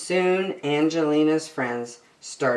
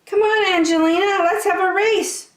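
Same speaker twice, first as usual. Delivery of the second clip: high, loud eager call; 5 dB louder than usual. Acted. Alice calling Angelina to race home, high and loud. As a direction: call out to your friend to race. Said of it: "called Alice".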